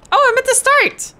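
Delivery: high, loud dismayed squeal; shocked shriek. Real, not acted. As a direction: yelp in sudden dismay. Realises he fell back.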